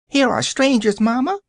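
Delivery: put-on high, excited child calling; shrill childish voice. A narrator's reading. Unseen child alerting Mamma to strangers, shrill and high. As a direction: call to mother like an eager little kid. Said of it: "the shrill and childish voice".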